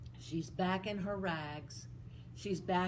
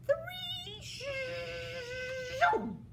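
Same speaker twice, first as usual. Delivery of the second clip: high sweeping three and zoom; magic countdown. Acted. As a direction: swoop up on the magic word. Magic transformation.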